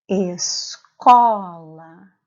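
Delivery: "Escola" very slow, with a loud, long co; slow, emphatic "Escola" booming on the middle syllable; stress on CO. Shown as a demonstration. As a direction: split "Escola" after es, then punch and stretch co. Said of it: "A sílaba tônica é a sílaba".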